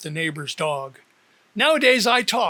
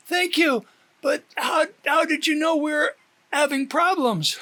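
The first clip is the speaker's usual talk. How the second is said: much higher, moved voice; emotional Teddy; 9 semitones higher than usual. A narrator's reading. Teddy thanks Dee on the phone, much higher and moved. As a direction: voice him overwhelmed.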